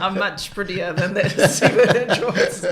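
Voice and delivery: slow, laughing, playful retort; laughing joke. Real, not acted. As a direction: tease back through a laugh. Jokes, laughing at comparison.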